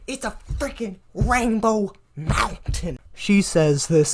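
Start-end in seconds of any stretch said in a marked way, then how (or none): 0.1-3.0 slow, indignant teacher scolding, stretching "rainbow mountain"; hammed-up outrage drawn out at a slow pace; mock furious teacher. Teacher scolding the class over the rainbow mountain, mock fury. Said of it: "she's so mad".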